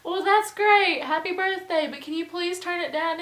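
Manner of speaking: high, loud polite pleading; insistent sweet plea. Retold or imitated. Roommate placating birthday host, high loud insistent plea. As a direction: congratulate him loudly, then press politely.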